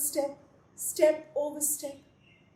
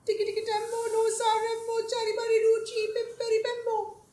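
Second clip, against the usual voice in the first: high, crying call; frightened cry; 8 semitones higher, faster than usual. A narrator's reading. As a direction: cry out in panic as he falls. Children cry as brother falls.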